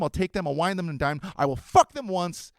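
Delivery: ordinary talk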